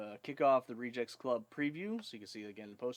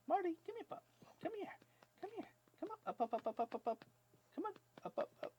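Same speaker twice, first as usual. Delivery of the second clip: very high coaxing pet voice; puppy talk; 4 dB quieter, faster than usual. Real, not acted. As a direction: coax the dog up in a high voice. Calling puppy to jump up.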